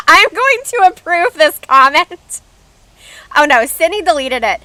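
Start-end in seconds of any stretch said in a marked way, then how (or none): 0.0-2.4 higher, louder, laughing through the words. Real, not acted. Streamer reacting to a troll comment in chat, laughing. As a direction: talk through a real laugh. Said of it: "I can't stop laughing".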